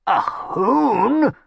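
high, shocked croaking cry; appalled croak. Acted. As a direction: croak in horror. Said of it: "croaked Chimwazzle, appalled".

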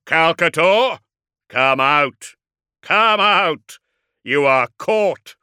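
booming challenge. A narrator's reading. Dreadnought challenges his cornered enemy to come out, booming. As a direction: thunder out a demand for surrender. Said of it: "he boomed".

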